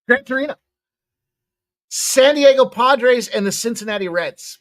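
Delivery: ordinary talk, nothing marked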